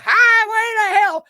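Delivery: high, loud, drawn-out holler; imitated party yell, stretched slow; rowdy yelling. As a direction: belt it out like a drunken singalong. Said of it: "up in the aisles yelling".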